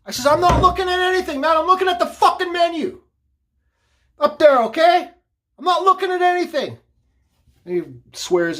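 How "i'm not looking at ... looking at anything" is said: very high, loud angry retort; yelling back. Retold or imitated. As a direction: shout it back across the restaurant, fed up. Acts out yelling back in quarrel.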